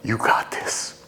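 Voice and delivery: reassuring whisper. Acted. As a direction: breathe it to him. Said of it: "I would do that as Dickie".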